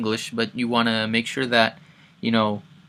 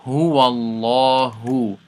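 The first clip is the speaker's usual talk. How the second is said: قُلْ هُوَ اللَّهُ chanted, louder, lips unrounded; Quran recitation; 5 dB louder, faster than usual. Shown as a demonstration. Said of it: "last time".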